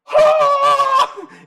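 sudden high loud laugh-shout; loud pained laugh. Real, not acted. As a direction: burst out in a yelping laugh. Retelling knee injury.

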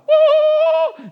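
sudden high whoop at the bright light; loud woo. Acted. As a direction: shout it out like a flash of light. Light from heaven shines.

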